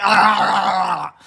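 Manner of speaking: long wordless Muppet-style holler; imitated Animal yell. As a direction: holler one drawn-out "ah" like Animal. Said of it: "He was always like".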